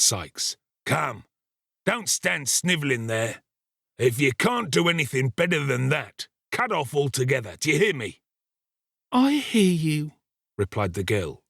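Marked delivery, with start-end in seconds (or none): 0.8-8.2 lightly acted scolding, pitched up from narration; bullying ruffian. A narrator's reading. Sikes bullying the girl. 9.1-10.1 forced lightness. A narrator's reading. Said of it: "forcing a laugh".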